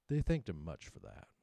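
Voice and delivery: ordinary delivery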